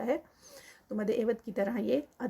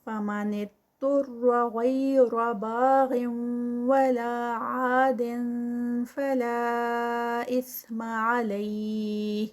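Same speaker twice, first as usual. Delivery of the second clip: louder melodic chant; Quran recitation; 5 dB louder, slower than usual. Shown as a demonstration. Teacher reciting the verse aloud, louder and melodic. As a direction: recite with heavy letters and held madd. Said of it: "recites the verse".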